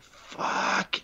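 a short breathy whispered swear; whispered curse. Retold or imitated. Realizing the inventor has no money, whispered curse.

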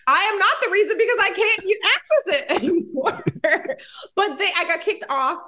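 real laughter breaking into a defence; laughing. Real, not acted. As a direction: protest while giggling. Laughing while defending herself.

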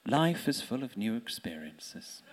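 whispered under the breath, slow; sotto voce. Retold or imitated. Wife muttering to her husband under her breath. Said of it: "very quietly, sotto voce under her breath".

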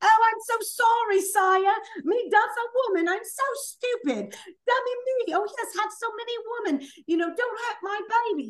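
very high, whimpering mock-servile voice; hammed-up grovelling peasant plea, high-pitched; Game of Thrones character. Sarcastically playing a meek fantasy character begging, mock whimper. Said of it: "one of those characters in Game of Thrones".

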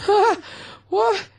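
a high, shocked yelp at the bill; shocked gasp. Retold or imitated. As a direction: squeal as if you can't believe the number. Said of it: "And you went".

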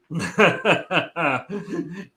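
sustained real chuckling laugh; real burst of amused laughter. Real, not acted. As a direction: laugh helplessly for a couple of seconds. Laughing at his own slip.

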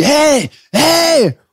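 high yelled hey into a phone; mock shout. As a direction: shout it across the street like a stranger. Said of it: "er schreit so in sein Handy".

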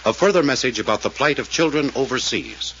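earnest announcer appeal, measured; radio appeal read. Acted. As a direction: plead with listeners like an old radio announcer. Sponsor appeal before the show.

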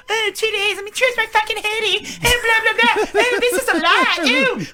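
high whiny mockery of a fussy girl; mocking whiny voice. Mocking Jesse Sims' fussy challenge.

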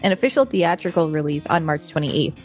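ordinary talk, nothing marked

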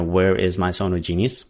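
usual speaking voice

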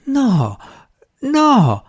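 high-pitched pleading cry; imploring. A narrator's reading. Terrified man begging the magician to stop, high imploring voice. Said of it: "implorò Drolight".

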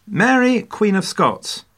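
grand announcement. Acted.

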